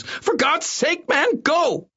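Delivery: slow, very high, exasperated screech; screaming octaves up. A narrator's reading. Doctor begs his guard to go, screaming octaves up. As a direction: drag out the shriek, way up high. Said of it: "his voice going up several octaves".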